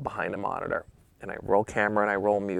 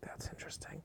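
barely audible, intrigued whisper; whispered aside; 23 dB quieter than usual. Retold or imitated. Photographer watching the actress on the monitor, intrigued whisper.

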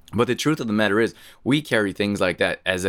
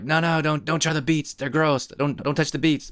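put-on higher, teasing kids' warning against the beets; mock warning; 8 semitones higher than usual. Kids teasing the little sister, higher mock-alarmed warning. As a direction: ham up the fake alarm to scare her off.

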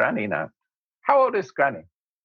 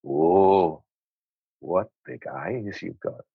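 low, slow mock come-on; 11 semitones lower, slower than usual. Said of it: "she's coming on to me".

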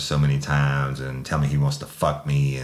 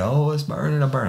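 high, whimpering cry of pain; 11 semitones higher than usual. Retold or imitated. Suspect under the water hose crying out, high and pained. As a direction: whine it like it still burns.

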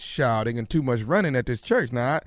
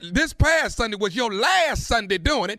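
stern; 9 semitones higher than usual. Acted. Heated argument escalates.

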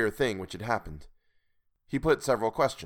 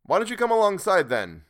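loud, high, testy question; somewhat snappish; 11 semitones higher, 8 dB louder than usual. A narrator's reading. Sailor challenging the hidden stranger, somewhat snappish. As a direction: query it with some impatience. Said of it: "I queried somewhat snappishly".

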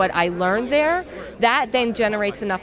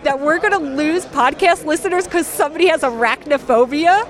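incredulous; 5 semitones higher than usual. Retold or imitated. Host retelling how she scoffed at a partner's fear, mock-incredulous. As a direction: playfully scoff at her worry. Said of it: "I'm like".

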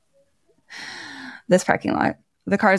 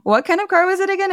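much higher, fast mock voice of the security lady; security woman; 12 semitones higher, faster than usual. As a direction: squeak it out like a ditzy clerk. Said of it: "And this woman is like".

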